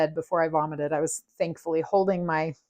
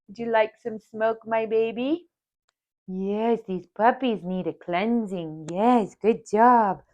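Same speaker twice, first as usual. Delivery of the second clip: sweet higher pet-talk voice; 4 semitones higher than usual. Real, not acted. To her dog while smudging the puppies, cooing baby talk.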